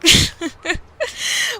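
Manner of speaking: high, light chuckling laugh; amused giggle. Acted. Wife laughs as husband admits he didn't, amused giggle. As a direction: giggle along at his confession.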